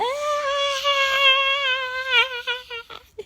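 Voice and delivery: high cackling laugh; real laughter. Real, not acted. Cracking up retelling the prank, real laugh. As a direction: burst out laughing.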